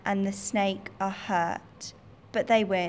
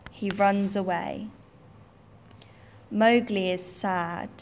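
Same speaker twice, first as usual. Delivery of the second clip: usual speaking voice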